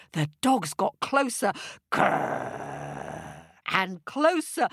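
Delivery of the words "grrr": deep low growl; dog growl. Acted. Dogs chasing monkey, deep growl.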